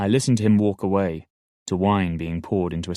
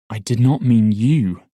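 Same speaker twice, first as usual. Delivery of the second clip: plain reading voice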